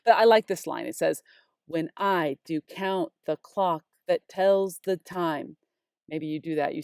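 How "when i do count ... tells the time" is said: "When I do count the clock that tells the time" slow and metrical; weight on "I", "count", "clock", "tells", "time"; tick-tock rhythm. Shown as a demonstration. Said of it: "I have that heartbeat or that tick-tock rhythm here".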